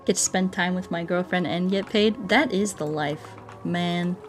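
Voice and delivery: ordinary talk, nothing marked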